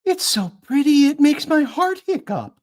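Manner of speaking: high, bright sing-song voice; delighted chirrupy sing-song; chirrupy Pepper. Acted. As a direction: gush it out in a chirpy lilt. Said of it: "chirrupy sing-song voice".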